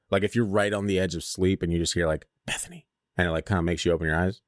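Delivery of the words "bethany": soft breathy whisper of a name. Retold or imitated.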